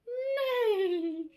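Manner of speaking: high whinnying horse neigh; horsey voice. Acted. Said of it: "in a horsey voice answered".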